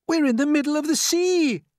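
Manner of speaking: very high, loud alarm; crying out. A narrator's reading. James seeing open water all round, high loud cry. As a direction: yell the discovery to everyone. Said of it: "cried James".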